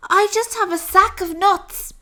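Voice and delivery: small and worried; nervous squirrel. Acted. Squirrel answers.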